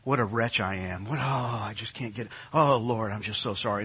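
quiet, self-pitying moan; mock wretched lament. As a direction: wallow in it, half-mocking. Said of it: "going, perverse comfort in obsessing".

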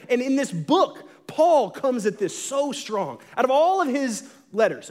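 ordinary talk

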